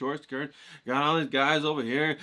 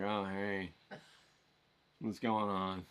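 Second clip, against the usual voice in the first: mumbled, softer hello; reluctant greeting; 6 semitones lower, 9 dB quieter, slower than usual. Retold or imitated. Kid grudgingly greets the boy his mom chose, softer. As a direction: say hi like you were forced to.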